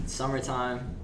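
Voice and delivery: usual speaking voice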